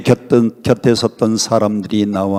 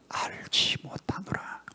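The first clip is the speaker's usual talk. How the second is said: very slow, hushed whisper; whispered denial; 19 dB quieter than usual. A narrator's reading. Preacher voicing Peter's last denial, slow whisper. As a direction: drop to a drawn-out whisper on the denial.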